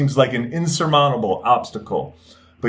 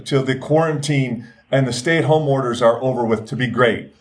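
ordinary talk, nothing marked

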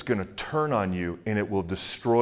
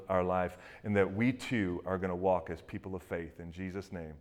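soft, earnest prayer, fading much quieter at the end; closing prayer; 3 semitones lower, 5 dB quieter than usual. Acted. Pastor praying over the congregation at the close, soft earnest prayer. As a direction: pray over the congregation, gently.

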